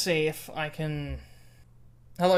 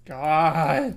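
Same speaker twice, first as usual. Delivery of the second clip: louder groaned exclamation; loud exasperation; 7 dB louder than usual. Real, not acted. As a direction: blurt it out fed up. Exasperated at game.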